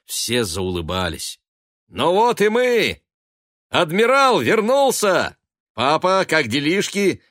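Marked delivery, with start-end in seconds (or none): 1.9-7.2 high, booming homecoming hail; exuberant shouting. A narrator's reading. Sailor son bursting in on his family, shouting. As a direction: shout it out, drawing the words long. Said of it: "кричал он".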